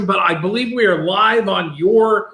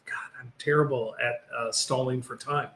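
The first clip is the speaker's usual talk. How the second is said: much lower and softer, half-whispered; hushed aside; 8 semitones lower, 12 dB quieter than usual. Real, not acted. Host admits to fumbling the stalling before a reveal, hushed aside. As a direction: drop to a near-whisper under your breath.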